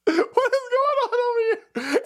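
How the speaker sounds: high, laughing shock, cracking up mid-line; laughing disbelief. Retold or imitated. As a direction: laugh through a stunned "what is happening". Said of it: "I was like".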